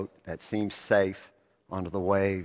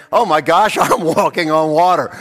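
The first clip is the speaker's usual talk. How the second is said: much louder, laughing disbelief; amazed laughing Peter; 9 semitones higher, 16 dB louder than usual. Acted. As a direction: burst out in giddy wonder. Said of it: "He begins to think".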